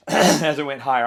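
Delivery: ordinary talk, nothing marked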